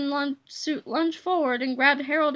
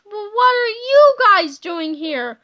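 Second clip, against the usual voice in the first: high, loud, surprised question; astonished cry; 4 semitones higher, 7 dB louder than usual. A narrator's reading. Man finds young selves at his door, crying out surprised. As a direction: ask it loudly, taken aback. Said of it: "cried old George".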